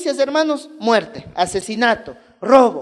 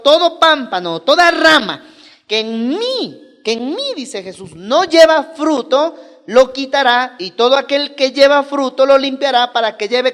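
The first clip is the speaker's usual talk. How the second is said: forceful scripture reading; 6 dB louder than usual. Acted. Pastor reading John fifteen to the congregation, forceful proclamation. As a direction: read Jesus' words like a proclamation. Reads Jesus' words at full force.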